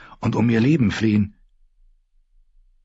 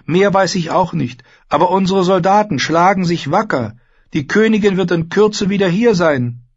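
high, raised-voice announcement; addressing a crowd; 12 semitones higher, 4 dB louder than usual. A narrator's reading. Girl calms the guests with news, addressing a crowd. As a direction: carry the news across the hall.

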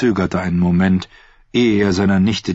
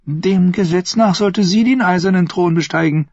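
patronizing; 11 semitones higher than usual. Acted. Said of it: "als wäre er irgendein törichter Narr".

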